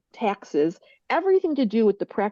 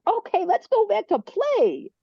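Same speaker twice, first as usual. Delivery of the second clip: put-on much higher and slow, a bright childlike chirp; eager kid; 12 semitones higher, slower than usual. Fire person urging everyone back to play, high childlike chirp. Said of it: "And the fire's like".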